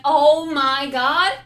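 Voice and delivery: loud, slow stunned gasp; surprised. Retold or imitated. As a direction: replay the stunned moment. Reenacts own reaction.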